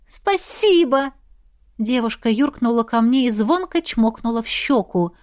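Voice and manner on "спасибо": high, slow drawn-out thanks; gushing gratitude, pitched way up; overjoyed thanks. A narrator's reading. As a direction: stretch it out with girlish delight. Overjoyed girl thanks him.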